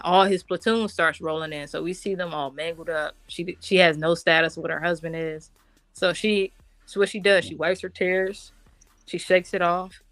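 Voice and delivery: normal talking voice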